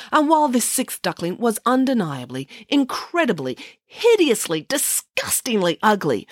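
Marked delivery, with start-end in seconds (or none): none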